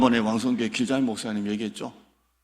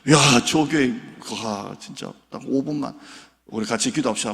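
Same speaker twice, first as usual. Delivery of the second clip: normal talking voice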